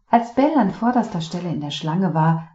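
usual speaking voice

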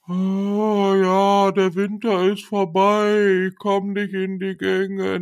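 mock the sleepy spring drivers; sluggish spring crowd. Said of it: "dass die Leute alle so". (träge Frühlingsmüde nachgemacht)

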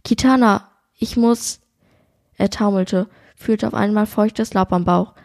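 steady, even read-aloud narration; story narration. A narrator's reading. Co-host reads the shooting scene aloud, calm narration. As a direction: carry the listener through the hunt scene.

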